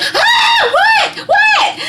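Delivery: very high, loud startled squeal; startled shriek. Retold or imitated. Mom startled by her daughter's call, shrieking. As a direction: shriek as if jumping out of your skin.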